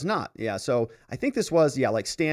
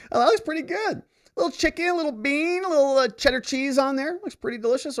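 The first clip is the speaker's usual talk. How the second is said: delighted; 10 semitones higher, faster than usual. Real, not acted. Admiring food pic, high voice.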